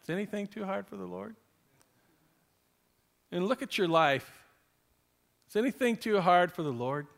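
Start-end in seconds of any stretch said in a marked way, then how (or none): 0.0-1.3 soft fast repeat of the verse; quiet repeat. Shown as a demonstration. Preacher repeating the verse softly to the congregation. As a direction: say it again softly, almost to yourself. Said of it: "such a classic verse".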